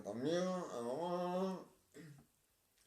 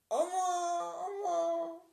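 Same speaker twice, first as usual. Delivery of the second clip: much higher, falsetto farewell like a woman; high woman's voice; slower than usual. Acted. Saying goodbye to Véronica, in a high mock woman's voice. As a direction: sing out the goodbye in a put-on female voice. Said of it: "[name] a fait une voix de femme".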